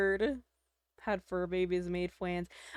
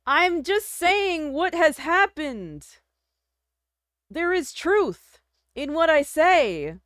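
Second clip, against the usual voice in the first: mock prophet; 5 semitones higher, slower than usual. Solemn mock proclamation.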